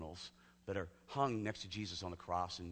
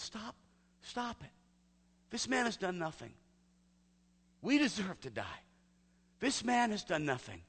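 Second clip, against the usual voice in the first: high, urgent cry, louder as it goes; shouting thief; 10 semitones higher, 5 dB louder than usual. Retold or imitated. The repentant thief shouting across at the other criminal, pleading and high. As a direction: plead out loud to save the innocent man. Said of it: "he shouts to the other criminal".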